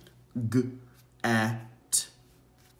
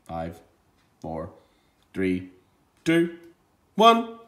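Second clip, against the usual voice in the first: countdown; 4 semitones lower, 3 dB louder, slower than usual. Acted. Teacher counting down for the children, rising. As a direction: count them down, climbing up in pitch.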